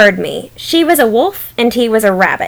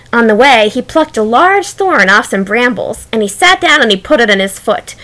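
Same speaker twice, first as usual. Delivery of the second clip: usual speaking voice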